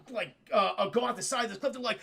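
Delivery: ordinary talk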